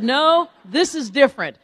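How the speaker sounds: loud, high protest to mom; excited insistence. Retold or imitated. Daughter assuring mom this time differs, excited and insistent. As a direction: insist at full volume, re-enacting it. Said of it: "I was so excited".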